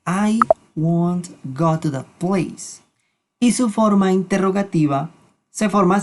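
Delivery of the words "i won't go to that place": slow, careful English example; slow model sentence. Shown as a demonstration. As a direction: read it slowly for learners. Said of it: "Por ejemplo".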